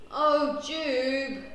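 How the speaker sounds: loud, drawn-out exasperation; groan. A narrator's reading. Dad sees the flung porridge, groaning. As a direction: groan it out, fed up. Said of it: "groans Dad".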